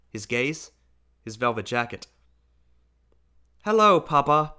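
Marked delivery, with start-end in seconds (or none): none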